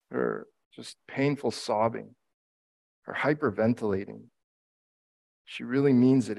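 ordinary talk, nothing marked

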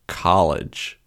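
"college" in a put-on American accent, as "cah-lidge"; terrible American accent. Shown as a demonstration. Carrying the demo to the next word, terrible American accent. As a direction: do a bad American "college" with an AH vowel. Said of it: "renowned for being terrible American accent".

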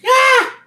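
high, loud startled yell; mock freak-out scream. Retelling Homer freaking out at the sign, high mock scream. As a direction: imitate Homer's panicked scream. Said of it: "freaks out".

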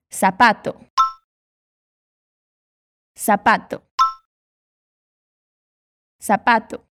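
"sapato" with an s sound, the Latin American way; Spanish sapato. Shown as a demonstration. Teacher models for the learner, Latin American s. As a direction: model "sapato" clearly with a plain s. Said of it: "Então, fala depois de mim".

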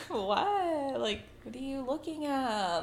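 mild, quiet questioning; acted-out question. Shown as a demonstration. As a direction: replay it lightly and innocently, as a scene. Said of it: "how did you say it?".

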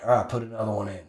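low, soft, quick command; quiet order. Retold or imitated. Coach restarting the ordeal, quiet casual order. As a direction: drop it casually under your breath.

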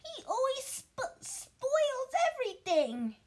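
hammed-up crying brat, slow and high; fake sobbing. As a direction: whine it like a spoiled boy faking tears. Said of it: "between huge pretend sobs".

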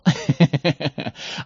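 short self-aware laugh; amused laugh. Real, not acted. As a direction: laugh at your own predictable answer. Chuckles at own predictable answer.

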